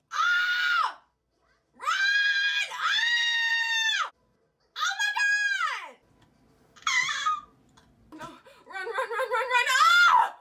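very high, loud panicked screams; shrieking run, run at the screen; terrified screaming. Real, not acted. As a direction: scream like you are being murdered. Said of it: "I scream like I'm being actually real life murdered".

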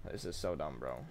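low, quiet grumble; muttered. Real, not acted. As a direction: mutter it under your breath. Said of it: "said again shouted".

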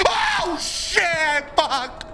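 panicked yelp. Real, not acted. Player yelps at the ghost lunging at him, panicked. Jumpscare in horror game.

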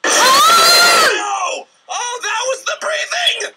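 high panicked yell; startled shout. Real, not acted. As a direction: yell out in fright. Jump scare, screaming.

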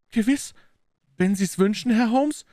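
very high, surprised reply; astonished young woman. A narrator's reading. Young woman agrees to the detective's wish, astonished. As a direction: agree in wonder, pitched right up. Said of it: "erwiderte das Fräulein verwundert".

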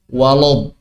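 "Walad" with a dark l, like "walod"; thick lam. Shown as a demonstration. Teacher showing a learner's mistake, "Walad" with a thick wrong lam. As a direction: thicken the l of "Walad", rounding the vowel to o. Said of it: "lamnya itu jadi tebel".

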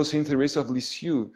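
normal talking voice

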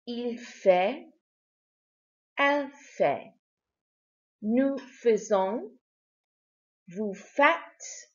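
each French pronoun and verb said slowly and clearly with gaps, Je, fais, Tu, fais; slow model French. Shown as a demonstration. Teacher modelling the French verb faire for the class, slow and clear. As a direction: pause after each form so the class can repeat it. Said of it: "And one more time".

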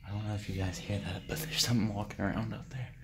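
low, soft undertone; hushed, wary. Real, not acted. Vlogger hearing something behind in the tunnel, hushed. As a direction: keep your voice down so you aren't heard.

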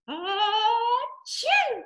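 high loud pretend sneeze; fake sneeze. Shown as a demonstration. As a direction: build up and sneeze big. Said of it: "somebody sneezed".

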